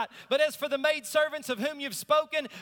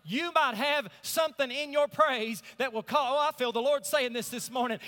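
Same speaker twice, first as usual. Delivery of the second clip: ordinary talk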